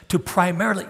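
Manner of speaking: normal talking voice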